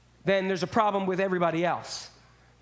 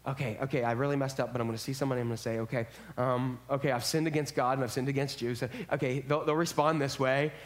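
muttered low, to himself, rehearsing an apology; nervous rehearsing; 7 semitones lower, faster than usual. Retold or imitated. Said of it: "he rehearses what he says".

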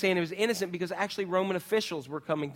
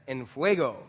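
Spanish accent; 4 semitones lower than usual. Shown as a demonstration. Youth pastor leading the group in a Spanish accent demo. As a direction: say it with a Spanish accent, rolling and proud. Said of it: "your best Spanish accent".

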